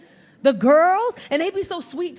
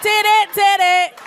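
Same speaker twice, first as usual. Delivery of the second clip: higher, louder, slow beeping tit it tit it; Nokia ringtone; 6 semitones higher, 7 dB louder, slower than usual. Retold or imitated. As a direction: imitate the old Nokia ringing. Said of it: "the Nokia you used to play Snake on".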